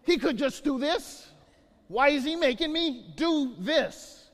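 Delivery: high, loud complaining voice; whiny complaint. Retold or imitated. Pastor voicing his younger self complaining, loud and high. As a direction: act out his own grumbling about the chore.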